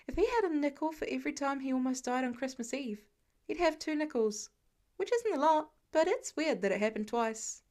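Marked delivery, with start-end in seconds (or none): none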